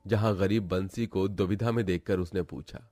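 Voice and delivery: usual speaking voice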